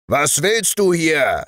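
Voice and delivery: giant bellowing. A narrator's reading. Second giant challenges the shepherd, roaring. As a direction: bellow it out to scare the intruder. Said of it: "brüllte der Riese".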